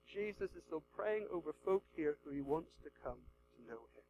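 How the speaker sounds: ordinary delivery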